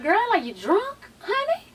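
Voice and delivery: high-pitched teasing quote. Retold or imitated. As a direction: squeal the question.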